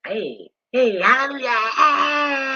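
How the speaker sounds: high, loud, slow hallelujah cry; imitating a worshipper crying out in praise; church praise shout. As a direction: shout hallelujah like you're caught in the spirit. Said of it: "say I'm praising".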